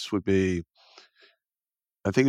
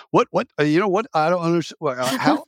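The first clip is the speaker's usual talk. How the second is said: imitated stutter; 7 semitones higher, faster than usual. Baffled boss facing a wall of lit lamps, stuttering. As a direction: sputter in confusion, restarting the phrase.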